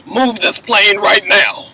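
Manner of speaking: slow, firm presidential command; commanding order. Retold or imitated. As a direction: give the order like nobody can refuse. Fitz orders the plane moved.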